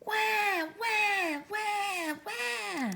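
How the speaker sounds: put-on high wailing baby cry. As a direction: wail like an unhappy baby. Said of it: "it yelled as loud as it could".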